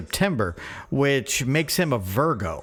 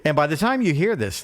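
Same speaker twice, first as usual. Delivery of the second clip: normal talking voice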